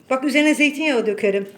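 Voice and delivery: loud, fast threat; threatening the cat. Real, not acted. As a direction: rattle off the threat. (kediyi hızlı ve yüksek sesle tehdit ediyor)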